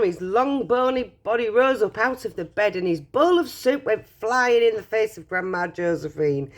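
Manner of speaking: usual speaking voice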